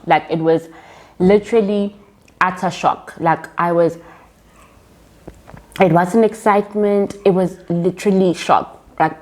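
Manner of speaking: usual speaking voice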